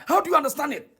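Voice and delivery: ordinary delivery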